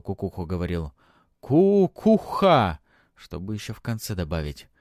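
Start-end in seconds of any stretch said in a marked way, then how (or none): 1.4-2.8 slow, loud, high mimicked chant. A narrator's reading. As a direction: parrot the priest's tongue.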